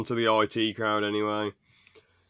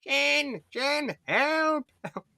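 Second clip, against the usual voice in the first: mock whimpering plea; much slower than usual. Fan quoting Moss begging Jen, high and pleading. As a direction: whimper for Jen like a scared nerd. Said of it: "Moss is like".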